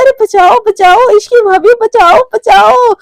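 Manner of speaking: high, loud, stretched-out shout; cry for help. Acted. Romi being beaten, calling Ishki for help, shouting. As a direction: yell for rescue, hammed up.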